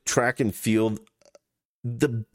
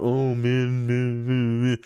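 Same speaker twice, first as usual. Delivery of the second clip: mocking complaint, repeating men; mocking whine; slower than usual. Host mocks feminists complaining about men, in a whiny parody. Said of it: "you've bitched your whole life about".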